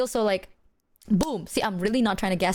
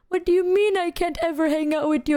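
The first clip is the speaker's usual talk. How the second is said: higher-pitched imitation of a sad friend; mock wounded questioning; sad, hurt protest; 7 semitones higher, 3 dB louder than usual. Imitating a friend told they can't hang out, sad protest. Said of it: "She was, like, more sad".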